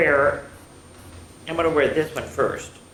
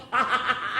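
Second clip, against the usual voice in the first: short high "ha-ha" burst; chortle; 8 semitones higher than usual. A narrator's reading. Bismarck in a laughing fit, chortle. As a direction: chortle helplessly.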